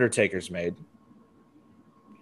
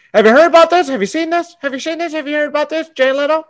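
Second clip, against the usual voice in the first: Jay Leno impression; 11 semitones higher, much faster than usual. Podcast host mocking Kangaroo Jack promo, high hyped host voice. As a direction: pitch it way up like a late-night host pestering the crowd.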